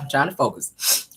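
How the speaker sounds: ordinary talk, nothing marked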